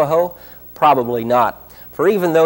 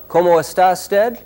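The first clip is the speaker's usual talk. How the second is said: loud, higher-pitched Spanish with a poor accent; 7 semitones higher, 6 dB louder than usual. Shown as a demonstration. Narrator showing viewers what Spanish sounds like, poor Spanish accent. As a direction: ask it in non-native Spanish, pitched up. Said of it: "especially with my poor accent".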